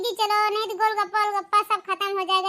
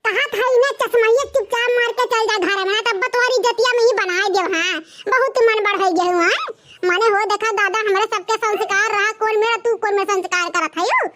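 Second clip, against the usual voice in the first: put-on louder, lamenting old mother-in-law; scolding mother-in-law; 4 semitones higher, 8 dB louder than usual. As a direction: wail and scold like a shocked village mother-in-law. (बहुओं को डाँटती सास, ऊँची आवाज़ में विलाप)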